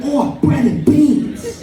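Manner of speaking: put-on a delighted ant in a British accent, lightly done; mock British ant accent. Ants spotting leftovers overnight, slow mock British delight. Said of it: "your ant accent".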